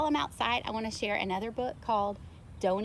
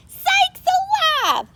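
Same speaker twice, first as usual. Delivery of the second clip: huge high shout, then slower; farmer shouting; 11 semitones higher, 11 dB louder, slower than usual. A narrator's reading. Farmer yelling after his runaway cows. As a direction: bellow it like a frantic farmer. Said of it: "The farmer shouted".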